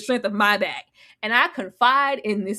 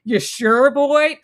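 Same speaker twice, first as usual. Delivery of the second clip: mocking doubt; 3 semitones higher, 5 dB louder, much slower than usual. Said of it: "You do".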